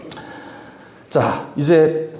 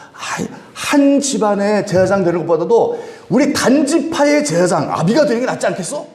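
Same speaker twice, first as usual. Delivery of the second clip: coaxing; 7 semitones higher than usual. Retold or imitated. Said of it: "꼬시니까".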